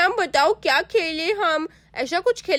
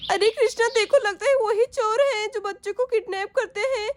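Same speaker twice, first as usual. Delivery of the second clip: high, tearful mother pleading with the robbers; a mother crying out in fear at gunmen; frightened mother; 6 semitones higher than usual. Acted. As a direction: voice a scared mother close to tears. Mother faces armed dacoits.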